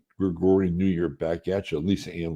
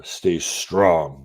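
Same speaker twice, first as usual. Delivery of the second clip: ordinary talk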